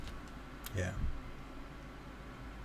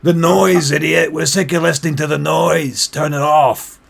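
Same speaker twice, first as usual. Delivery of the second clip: loud, exasperated heckler; raised, fed-up tavern shout; angry shouting; 5 semitones higher, 10 dB louder than usual. Acted. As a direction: yell at an idiot over the racket. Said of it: "they start shouting".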